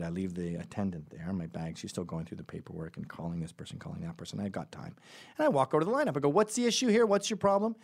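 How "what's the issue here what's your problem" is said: raised, higher challenging voice; retold angry challenge; confrontational. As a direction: demand an answer, loud and high.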